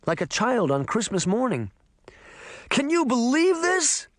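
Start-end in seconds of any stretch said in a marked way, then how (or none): 2.7-4.1 very high, elated exclamation; giddy disbelief, voice soaring; overjoyed. A narrator's reading.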